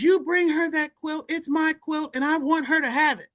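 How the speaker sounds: high, firm order to the daughter; insistent old lady. Retold or imitated. Audrey ordering her daughter to give Tony the quilt: high firm command. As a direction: insist she hand over the quilt. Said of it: "And Audrey says".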